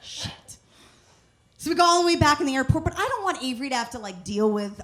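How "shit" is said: whispered, breathless swear; whispered curse. Retold or imitated. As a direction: mutter it under your breath in dismay. Realizing keys left behind.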